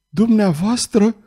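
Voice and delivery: high, slow, startled stammer; frightened stammer. A narrator's reading. Girl startled by the duke, stammering high and slow. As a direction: caught off guard, she falters. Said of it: "bâigui ea înspăimântată".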